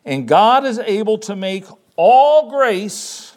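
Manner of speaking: "all" drawn out loud and high; slow right down and hit "all" hard; stress on all. Shown as a demonstration. Preacher stressing a verse's key word to the congregation, "all" stretched and punched. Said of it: "I'm emphasizing the word all".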